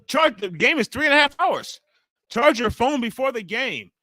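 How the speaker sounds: high, loud mock outrage; mocking own reply. Streamer quoting his reply to a troll, high and loud mock outrage. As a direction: squeal it back in a mocking voice. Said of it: "I was like".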